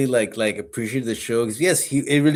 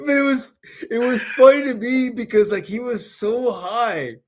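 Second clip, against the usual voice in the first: laughing; 12 semitones higher than usual. Real, not acted. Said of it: "laugh cry".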